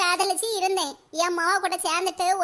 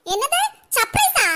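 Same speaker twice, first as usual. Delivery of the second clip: shocked surprise; 5 semitones higher, 5 dB louder, slower than usual. Acted. Hearing about the surprise gift.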